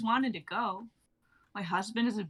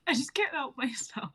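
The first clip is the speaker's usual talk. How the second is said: ordinary delivery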